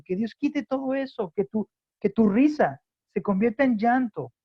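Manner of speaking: loud, high-pitched preaching plea; impassioned exhortation. Acted. Preacher urging the congregation to break before God, impassioned. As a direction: cry it out, urging them to repent.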